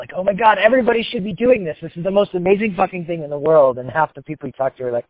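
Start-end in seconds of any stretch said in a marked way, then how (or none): 0.1-3.8 high, excited mock gushing; gushing, stoked. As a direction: gush like an over-enthused gym convert. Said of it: "you're so stoked".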